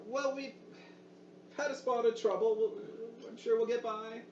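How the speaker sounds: put-on high, trembling old woman; high old lady voice; old woman's voice. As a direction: play a brave grandmother hiding trouble. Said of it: "the old woman's voice".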